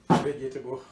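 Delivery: ordinary delivery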